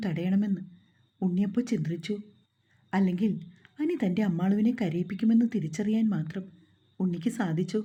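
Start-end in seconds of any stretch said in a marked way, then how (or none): none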